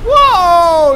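loud thrilled wow; loud amazed gamer wow; excited wow. Real, not acted. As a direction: blurt out amazement. Reacting to superpower again.

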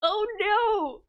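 high startled cry of dismay; alarmed oh no. Real, not acted. Player panicking over a story choice, high startled cry.

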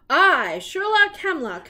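high, loud pompous introduction; grand self-announcement. Acted. Sherlock proudly presents his finds, loud and grand. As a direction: boom your own name proudly.

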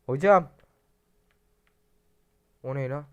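loud high call of "Hocam"; urgent shout for the hocam. Real, not acted. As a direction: yell for the imam across the yard. Calling the imam.

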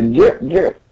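high squeaks rising; squeaky jerk sounds. Retold or imitated. Imitating a game sound.

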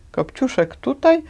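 high, disbelieving question; can't believe she's here. Acted. Dragon can't believe Cinderella is here, pitched high. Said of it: "z niedowierzaniem zapytał smok".

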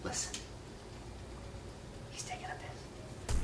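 very soft, slow whisper. Pretend. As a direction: whisper as if not to be overheard. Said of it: "Why are you whispering?".

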